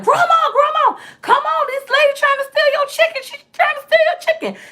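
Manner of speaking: very high-pitched imitation of a child shouting; squeaky mock kid yell, raising the alarm; seven-year-old cousin. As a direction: mimic a little kid urgently calling for Grandma. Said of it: "my cousin was like".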